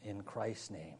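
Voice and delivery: low, hushed prayer with long pauses. Real, not acted. Preacher asking God to excel in grace, hushed prayer. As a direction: pray softly and reverently, phrase by phrase.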